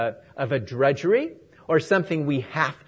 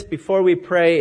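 usual speaking voice

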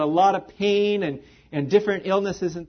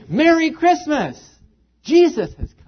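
joyful proclamation; 9 semitones higher, 6 dB louder than usual. Acted. Simeon greeting the baby in the temple, joyfully proclaiming. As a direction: announce it like the best news in the world.

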